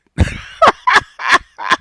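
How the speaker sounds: high unvoiced mocking cackle; mocking laugh. Real, not acted. Laughing at his rival's nerve, high mocking cackle. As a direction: laugh him off high and breathy.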